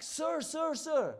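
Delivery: high, loud calling after someone; urgent loud call of sir. Retold or imitated. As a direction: call him back across the street. Man calling after him.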